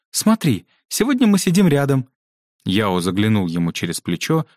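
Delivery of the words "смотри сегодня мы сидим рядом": high, excited announcement; joyful exclamation. A narrator's reading. Yusuf spots his name beside his friend's, joyful. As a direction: cry it out with delight, pitch well up. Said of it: "радостно воскликнул".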